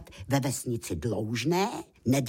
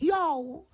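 high drawn-out "jou"; warning exclamation. A narrator's reading. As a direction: let out a high yelp of alarm. Vypravěč varuje před pastí. (vysoké varovné zvolání)

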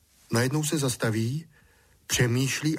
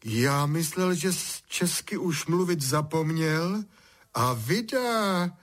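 high, slow mock-wonder; smiling, teasing; 7 semitones higher than usual. A narrator's reading. Father teases son whose Czech is back, amused. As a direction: draw it out with a teasing smile. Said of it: "usmál se tatík".

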